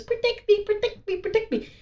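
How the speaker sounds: high-pitched, repeating robot plea; panicking robot. Acted. Robot clinging to the real kid for protection, high mock panic. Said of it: "So the robot, like, takes the".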